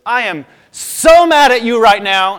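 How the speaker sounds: put-on high, loud, slow furious girl; bossy little princess. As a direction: stamp and yell like an angry girl. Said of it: "stomped her foot and goes".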